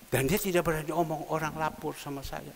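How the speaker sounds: ordinary delivery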